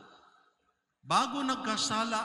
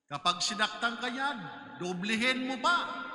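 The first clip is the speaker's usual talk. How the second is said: rising, louder preaching, building hard; fiery preaching; 3 semitones higher, 3 dB louder than usual. Acted. Priest preaching to his congregation, forceful and rising. Preacher voicing the world's teaching.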